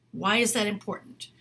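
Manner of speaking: usual speaking voice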